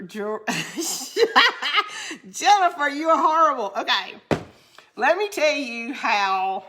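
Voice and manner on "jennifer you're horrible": laughing out the name; real giggle while scolding. Real, not acted. Laughing at friend's request.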